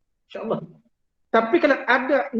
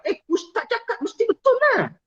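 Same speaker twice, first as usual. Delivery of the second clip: mock boast; 14 semitones higher than usual. Joking about his own authority.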